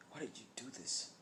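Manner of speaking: quiet, wounded question; small, hurt voice; tearful question. Acted. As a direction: ask it softly, as if near tears. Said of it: "with tears in his eyes and says".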